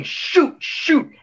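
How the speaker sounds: high, mocking sitcom "Shoot, shoot"; mock the sitcom character who keeps saying it. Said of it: "they keep saying".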